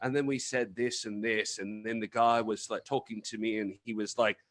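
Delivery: put-on light British accent, slipping into a storyteller's lilt; a hint of British accent while retelling a story. Guest mimicking a British wrestler telling a story, in a mild British accent. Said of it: "he'll go from his British accent".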